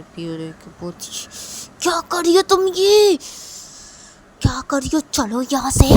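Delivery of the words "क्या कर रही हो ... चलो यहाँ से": high, loud outraged yell; angry shouting. Acted. Piu catching Rui with her daughter, angry shouting. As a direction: explode at her and order her out. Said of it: "गुस्सा हो जाती है".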